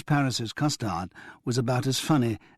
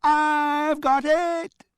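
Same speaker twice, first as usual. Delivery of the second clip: very high, loud and very slow squeal; a tiny boy shrieking the words in glee; child mimicking shout; 6 dB louder, much slower than usual. A narrator's reading. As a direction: Damien copies the shout, very high and drawn out. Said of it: "shouted Damien".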